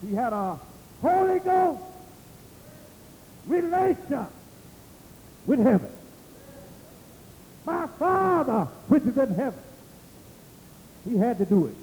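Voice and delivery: high-pitched, insistent preacher cadence; emphatic pulpit proclamation; preaching. Acted. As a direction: drive each phrase home high, with pauses between. Preaches at full force.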